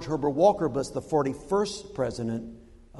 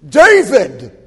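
loud, high shout of the name; booming challenge; 8 semitones higher, 18 dB louder than usual. Acted. Giant behind the king calls his name, booming challenge. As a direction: call him out from behind, full volume. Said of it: "he hears a voice behind him".

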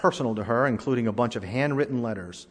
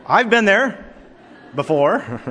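higher, louder with a laugh; laughing confession; 7 semitones higher, 6 dB louder, slower than usual. Real, not acted. Pastor confessing to congregation, laughing. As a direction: admit it through laughter. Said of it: "laughs at himself".

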